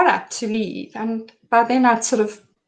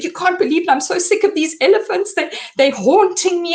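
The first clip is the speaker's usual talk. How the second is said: higher-pitched, overwrought retelling; a retold near-hysterical outburst, pitched up; frantic distraught babbling; 8 semitones higher than usual. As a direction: replay the panic of someone unravelling. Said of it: "I'd gone mad".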